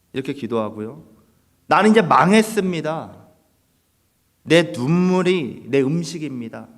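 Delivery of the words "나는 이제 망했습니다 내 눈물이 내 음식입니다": louder, slow cry of a psalmist pouring out grief; raw lament prayer. Retold or imitated. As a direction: plead it out loud like a broken psalmist. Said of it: "막 이렇게 기도해요". (소리 높여 탄식하는 기도)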